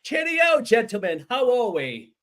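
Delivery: loud, high-pitched mock British lordly welcome; British accent. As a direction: play a pompous British lord introducing guests. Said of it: "have gone full British".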